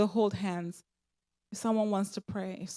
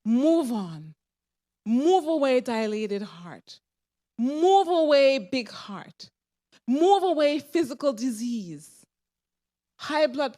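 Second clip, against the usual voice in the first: loud, slow, commanding pulpit cries; fiery healing proclamation; preaching at full force; 3 semitones higher, 7 dB louder, slower than usual. Acted. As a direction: drive each command out like a revival preacher.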